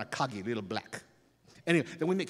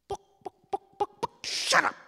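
chicken clucking; 6 dB louder than usual. Retold or imitated.

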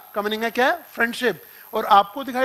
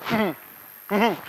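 stifled chuckle. Shown as a demonstration. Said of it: "वो हंसते-हंसते ना यूं कर लेते हैं".

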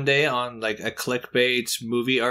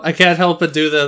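ordinary talk, nothing marked

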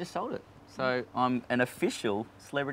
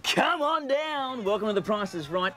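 high, slow booming announcer call; big showbiz announcer demo; game show announcer; 11 semitones higher, 3 dB louder, slower than usual. Shown as a demonstration. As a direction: stretch it out like a TV host. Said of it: "give 'em a demo".

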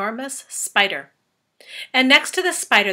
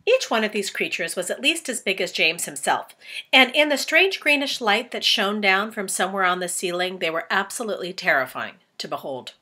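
plain reading voice

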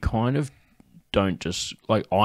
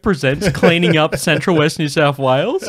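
higher-pitched joke show announcement; mock TV presenter; 8 semitones higher, 4 dB louder than usual. Joking title for a cleaning show, mock announcer laughing. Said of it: "fucking Don Burke presents".

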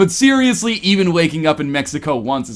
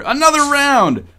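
calling out; 10 semitones higher than usual. A narrator's reading. Alyssa motions to the bartender, calling out. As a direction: holler it like a party girl.

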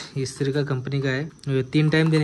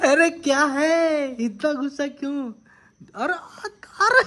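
high, stretched-out mock protest; 9 semitones higher, 4 dB louder, slower than usual. Real, not acted. Father asking angry baby why, high mock protest. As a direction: draw it out high, playfully taken aback.

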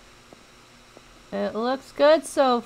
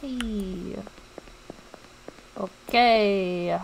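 ordinary talk

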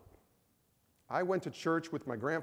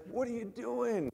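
wife's alarm; 9 semitones higher, slower than usual. Retold or imitated. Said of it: "She's like".

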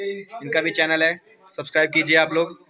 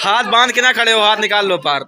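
calling out; 4 semitones higher, 7 dB louder than usual. Real, not acted. Calling out to the group.

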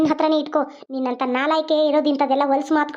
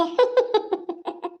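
evil laugh; 4 semitones higher, 4 dB quieter than usual. Acted. Villain plots to harm everyone.

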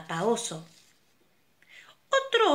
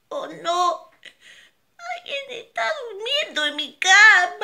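much higher, louder crying cub voice; baby bear crying; 11 semitones higher, 8 dB louder than usual. Acted. Baby bear finds the girl in his bed, high crying wail. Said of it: "el osito pequeño dijo".

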